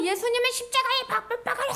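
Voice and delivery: high-pitched acted mob cry; crowd's loud demand. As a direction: call it out like a crowd demanding punishment. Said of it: "큰 소리로 해가지고".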